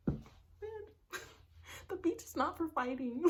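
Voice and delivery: high, laughing remark; laughing aside. Real, not acted. Joking to viewers about fighting on the beach, high and laughing.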